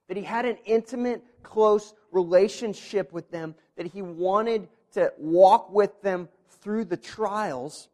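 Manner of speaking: loud, rising preacher's voice; impassioned preaching. Acted. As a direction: preach it with growing fervor. Preacher builds to full force.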